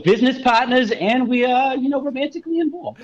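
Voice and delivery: high, strained bro voice; high put-on voice. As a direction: play the smug business partner. Bro couple parody continued.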